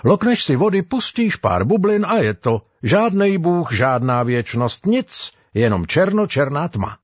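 high-pitched character voice dismissing the afterlife; dissenting sailor. Acted. Sailor tells the men there is no God, high dissenting voice.

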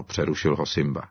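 plain reading voice

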